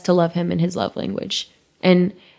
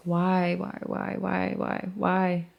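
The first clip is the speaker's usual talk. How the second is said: much softer, fading repeated why; despairing why; 8 dB quieter, slower than usual. Retold or imitated. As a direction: ask it almost to yourself. Despairing inner cry retold.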